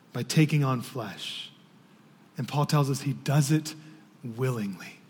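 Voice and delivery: low, soft, measured sermon delivery; hushed preaching. Real, not acted. Preacher to congregation on the Son of God becoming a child, hushed. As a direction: drop down low and quiet, phrase by phrase.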